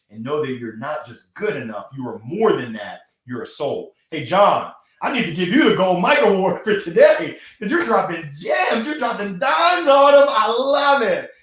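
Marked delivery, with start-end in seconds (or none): 6.0-11.3 high, loud, shouted praise; hyped praise. Real, not acted. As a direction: shout it out with joy.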